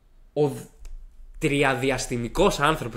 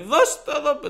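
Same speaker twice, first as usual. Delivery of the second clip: high, louder put-on demand; imitated demanding headmaster; 6 dB louder, slower than usual. Headmaster asks student for phone number, high mock demand. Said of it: "Μου λέει".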